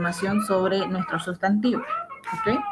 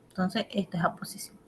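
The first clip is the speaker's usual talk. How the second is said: susurrado, para sí misma; susurro; 8 dB quieter, faster than usual. Real, not acted. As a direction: lo dice en voz baja, aparte. Maestra susurra aparte. (en susurro)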